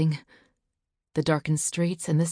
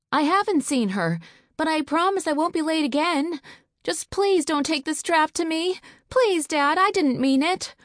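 put-on high, louder scared boy; frightened pleading; 11 semitones higher, 5 dB louder than usual. Son begging his father not to hit him, high and frightened. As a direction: beg not to be whipped.

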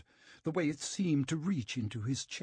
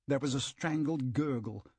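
usual speaking voice

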